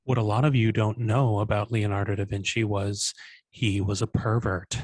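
low, measured public-radio voice; deep, deadpan NPR parody; mock NPR narration. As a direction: narrate it like a serious radio documentary. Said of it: "like, N P R thing about Da Vinci".

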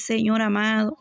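ordinary delivery

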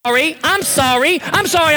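mocking apology, climbing in pitch; hammed-up fake contrition. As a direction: repeat it with building sarcastic force. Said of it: "He didn't say".

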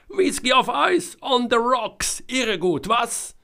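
sehr hoch, laut und übermütig; übermütig lachend. A narrator's reading. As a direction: den eigenen Witz feiern. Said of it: "Jonas lachte erneut drauf los". (Jonas feiert lachend seinen Whisky-Witz, sehr hoch und übermütig)

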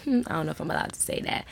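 quick, giggly aside after a slip; laughing aside. Real, not acted. Host laughing after saying a curse word on air, quick giggly aside. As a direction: laugh it off and hurry through.

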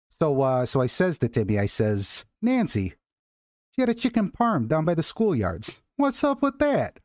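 hammed-up Chicago storyteller, jumping high; high, comic Chicago wiseguy; Chicago accent. Shown as a demonstration. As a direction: play up a Chicago accent with mock indignation. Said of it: "has the best Chicago accent".

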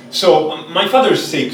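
ordinary delivery

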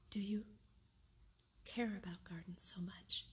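hesitant question broken by a long pause; said slowly. A narrator's reading. Guardian wonders at the girl's wish, speaking haltingly. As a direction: stop after the opening words, then carry on evenly. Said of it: "he said slowly".

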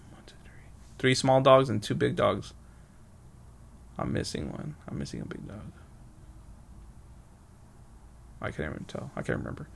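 whispered counting under the breath; soft private mutter while trying to remember; muttering to self. Real, not acted. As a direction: count it off to yourself, barely voiced. Counting dogs to himself under breath.

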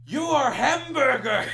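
high, slow accusation breaking into laughter; hammed-up horror, laughing through it. Mock-raging [name] at hamburger fan.

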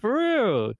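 high squeaky "for real"; mock surprise. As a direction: squeal it, hammed-up. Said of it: "I'm like".

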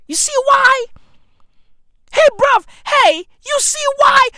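high, loud comic outrage; high-pitched insistent yell; exasperated yelling. Acted. Exasperated rant about ignorant people.

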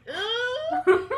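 loud, high drawn-out groan with a laugh behind it; mock-dismayed "ugh". Actor recalling the director's effortless accent, mock-dismayed groan.

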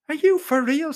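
sharply high-pitched disbelief; scornful, high-voiced question; incredulous. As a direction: challenge him, pitch shooting right up. Acted quarrel, scornful disbelief.

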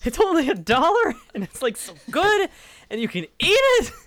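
very high, loud excited ad read; hammed-up commercial enthusiasm; ad pitch voice. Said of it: "[name], that's your cue?".

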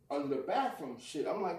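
ordinary talk, nothing marked

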